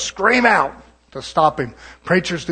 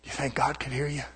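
softer whisper to a shouting preacher; whispered aside; 6 semitones lower, 14 dB quieter than usual. Retold or imitated. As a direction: lean in and whisper it. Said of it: "And I said".

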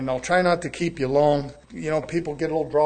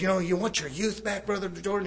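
Brother Pike's voice; 2 semitones higher than usual. Retold or imitated. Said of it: "speaking like this".

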